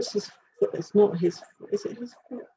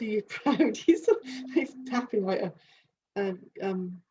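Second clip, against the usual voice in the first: usual speaking voice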